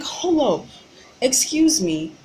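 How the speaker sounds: exasperated interruption; indignant hold up. Retold or imitated. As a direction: stop him cold with outrage. Confronting driver in retold argument.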